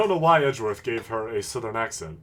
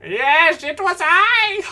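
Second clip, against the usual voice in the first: much higher, louder, slow proud skeleton shout; Papyrus voice; 8 dB louder, slower than usual. Pretend. Lawyer revealing himself as the witness in a loud, high Papyrus voice. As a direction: crow it out like a boastful cartoon skeleton. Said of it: "Great Papyrus!".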